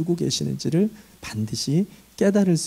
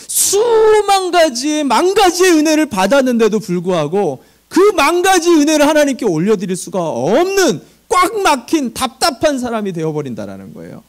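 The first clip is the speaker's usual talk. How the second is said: impassioned preaching; 10 semitones higher, 4 dB louder than usual. Real, not acted. Pastor preaching to the congregation about grace, impassioned. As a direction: press the point with fervor.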